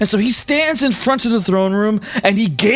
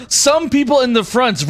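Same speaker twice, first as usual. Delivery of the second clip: ordinary delivery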